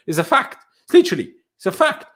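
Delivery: ordinary talk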